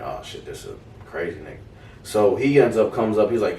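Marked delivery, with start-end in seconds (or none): 0.0-1.6 low, soft under-the-breath dread; muttered dread. Retold or imitated. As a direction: say it low and much softer. Dread inner thought.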